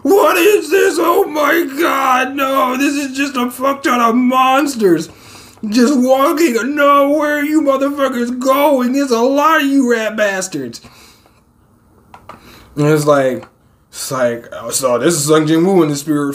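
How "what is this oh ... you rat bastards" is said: high, sustained mock-panicked shouting; high-pitched hammed-up freak-out; alarmed disbelief. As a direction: cry out at the sight of an army. Alarmed reaction to a monster army.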